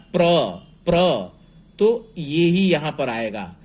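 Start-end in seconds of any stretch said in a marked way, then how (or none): 0.1-1.3 प्र said as one tight cluster, no vowel between प and र; teacher sounds out प्र as one blend; blended pra. Shown as a demonstration. Teacher demonstrates the joined sound प्र to students. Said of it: "र पहले आ रहा है".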